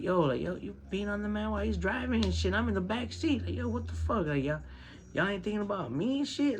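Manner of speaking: high, disbelieving retold rant; indignant outburst. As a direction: complain like you can't believe they forgot you. His indignant rant in back seat.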